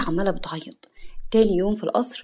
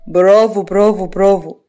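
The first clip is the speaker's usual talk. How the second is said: sarcastic bravo; 7 dB louder than usual. Acted. Mocking clap at her speech.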